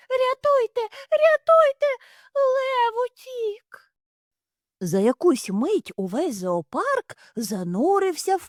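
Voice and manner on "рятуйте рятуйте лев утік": very high, loud shrieks; panicked screaming. Acted. A hare screams that the lion escaped.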